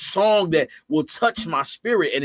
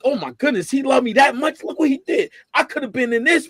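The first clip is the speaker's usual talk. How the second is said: higher, louder rush of awe, one near-shout; overwhelmed exclaiming; 8 semitones higher, 3 dB louder than usual. Retold or imitated. Worshipper reliving God's love, higher, overwhelmed exclaim. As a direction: burst out amazed at being loved. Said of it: "You like".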